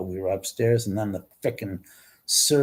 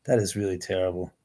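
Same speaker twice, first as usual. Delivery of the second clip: normal talking voice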